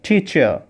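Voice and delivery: টিচার with a clear ch sound, not s; correct pronunciation. Shown as a demonstration. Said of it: "perfectly যদি উচ্চারণ করি". ("টিচার" চ দিয়ে, স নয়)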